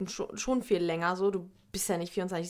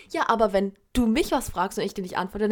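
ordinary talk, nothing marked

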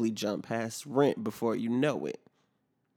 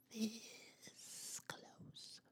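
slow, unvoiced whisper; slow whispered admission of near defeat; slow dread whisper; 11 semitones higher, 21 dB quieter than usual. Real, not acted. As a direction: breathe it out slowly like a shameful confession. Dreading settling for an Android.

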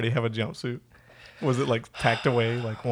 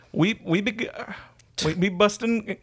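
high, botched British accent sliding into Jamaican; badly faked accent, accidentally Jamaican; British turned Jamaican; 9 semitones higher than usual. Shown as a demonstration. Podcaster retries British, lands on bad Jamaican accent. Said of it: "When did your British turn Jamaican?".

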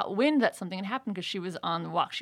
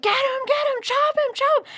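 high, loud battle cry; egging each other on, higher and louder; shrieking get him in a play fight; excited yell; 6 dB louder than usual. Retold or imitated. Urging in mock sword fight.

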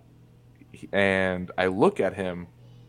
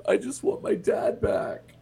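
put-on much higher, small broken child voice; a hurt ten-year-old pleading; crying kid. Boy bawling outside, wanting his dad, tearful high voice. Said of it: "And he's like".

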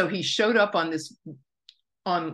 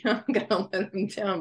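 ordinary delivery

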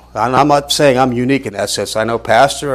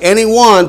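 any one lifted high and loud; stress on any one; 8 semitones higher, 6 dB louder than usual. Shown as a demonstration. Preacher pointing out the italicized words, stressing any one. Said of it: "you notice man's italicized".

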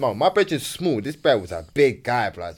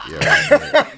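high, louder greeting pushed through laughter; laughing reply; 5 dB louder than usual. Retold or imitated. A guy greeting his hookup's boyfriend, laughing. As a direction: chirp it back while laughing.

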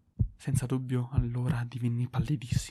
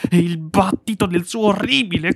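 high, loud, slow desperate shout; screaming confession; 4 semitones higher, 11 dB louder, slower than usual. A narrator's reading. Killer screams his confession to the officers. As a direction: break down and scream the truth.

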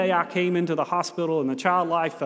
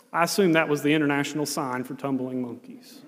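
ordinary talk

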